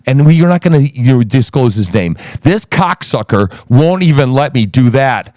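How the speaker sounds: normal talking voice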